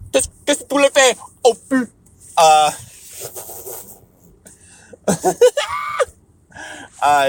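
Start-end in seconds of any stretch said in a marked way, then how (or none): 0.1-1.9 high, fast bubbly French phrasebook voice; giggly French. Pretend. Speaker showing off phrase-book French, giggly and high. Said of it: "I get giggly, get bubbly when I talk another language". 5.1-6.0 high squealing laugh; giggly shriek laugh. Real, not acted. Speaker giggling after her own French, high squeal. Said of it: "I get giggly".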